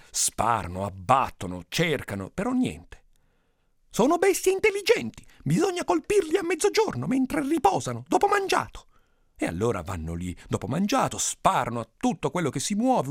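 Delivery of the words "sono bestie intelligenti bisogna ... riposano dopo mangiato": very high, squeaky-pitched schemer; high-voiced villager. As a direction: propose the plan in a falsetto-high voice. Second villager proposes midday attack. (paesano dalla voce acutissima)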